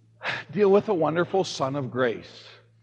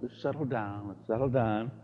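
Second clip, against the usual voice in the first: calming self; 5 semitones lower, 7 dB quieter than usual. Retold or imitated. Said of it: "and I'm like".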